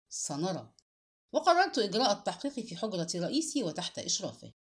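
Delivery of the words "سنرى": low, understated resentment; contained anger in a lower voice; resentful. A narrator's reading. Officer answering the accused man, resentful. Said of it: "فقلت بحنق".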